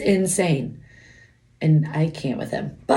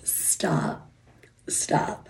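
hushed, separate whispers; whispered stop; 10 dB quieter than usual. Real, not acted. Host checking herself after laughing, whispered.

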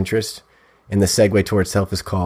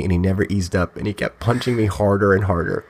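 ordinary delivery

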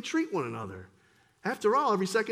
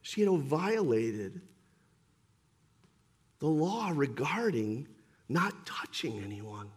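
much softer and lower; hushed sermon tone; 9 semitones lower, 7 dB quieter than usual. Acted. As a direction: let it land quietly. Preacher drops to hushed tone.

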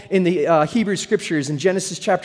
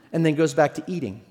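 ordinary talk

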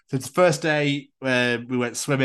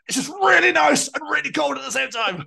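high, loud shout of delight; excited shout; 3 dB louder than usual. Retold or imitated. Swimmer in the rooftop pool, shouting happily. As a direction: call it out thrilled. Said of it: "I was like".

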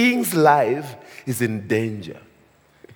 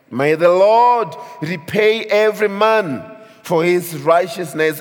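measured, raised reading voice; scripture reading; 4 semitones higher, 3 dB louder than usual. Acted. Preacher reads David's words to Saul, measured.

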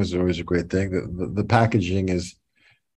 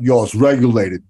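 hammed-up New York accent; 6 dB louder than usual. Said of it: "That's my New York accent was coming out".

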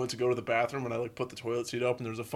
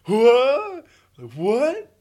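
stammered, much higher and louder, stunned; record scratch disbelief; 10 semitones higher, 10 dB louder, much slower than usual. Retold or imitated. Friend says the movie's crap while watching it, loud high disbelief. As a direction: stop dead in total disbelief, loud and high. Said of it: "I had to do the fucking, like, the record scratch".

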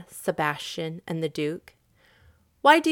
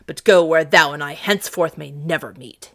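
loud, low, angry farewell; angry exclamation; 3 semitones lower, 8 dB louder than usual. Acted. Duke banishing the page, angry exclamation. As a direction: cast them off forcefully. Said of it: "the Duke exclaimed".

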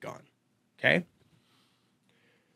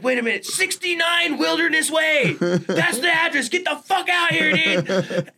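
frantic laughing shout; 12 semitones higher than usual. Retold or imitated. Man shouting his address to a friend on the phone, laughing. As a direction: blurt the address in a frantic rush. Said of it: "and I'm like".

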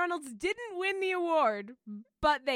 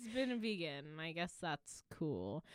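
mock deadpan; 11 semitones lower, 8 dB quieter than usual. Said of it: "You're doing a really great job of hyping him up".

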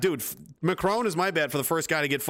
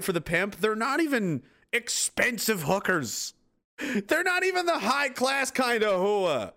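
rising rant; 6 semitones higher than usual. Real, not acted. Ranting about cheap sellouts.

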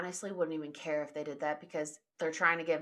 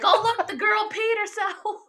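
mocking onlooker; 13 semitones higher, 8 dB louder than usual. Imagined onlookers mocking her.

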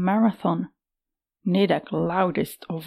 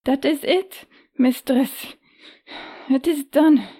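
servant voice; 7 semitones higher, 3 dB louder than usual. Acted. Servant Milia addresses mistress.

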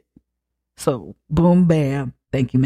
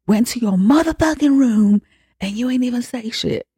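high-pitched retold tirade; hammed-up angry accusation; acted losing her mind; 8 semitones higher than usual. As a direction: scold in imitated outrage, pitch riding high. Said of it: "losing her fucking mind".

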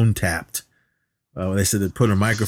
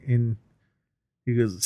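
ordinary talk, nothing marked

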